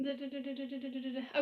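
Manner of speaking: soft rapid da-da-da; idle running syllables under your breath; absent-minded filler. Real, not acted. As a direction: burble quietly while searching. Filling time while looking for notes.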